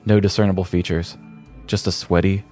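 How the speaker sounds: ordinary delivery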